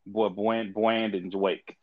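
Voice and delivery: "Bland" stuttered and restarted, with w-like R sounds; childhood stutter. Shown as a demonstration. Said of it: "I used to stutter".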